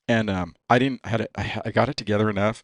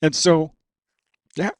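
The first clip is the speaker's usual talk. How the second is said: real tears pushing the voice higher and louder; tearing up; 5 semitones higher, 6 dB louder than usual. Real, not acted. Racer still tearful about his dad, choked up. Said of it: "sorry, I'm tearing up here".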